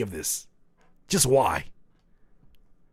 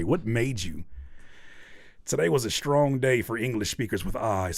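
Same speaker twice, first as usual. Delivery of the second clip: normal talking voice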